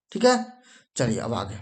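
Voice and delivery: ordinary talk, nothing marked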